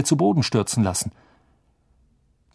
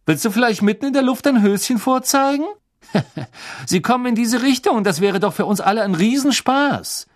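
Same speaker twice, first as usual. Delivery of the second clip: put-on sneering rich boy; 11 semitones higher, 4 dB louder than usual. A narrator's reading. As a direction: look down on them and enjoy it. Malfoy mocking.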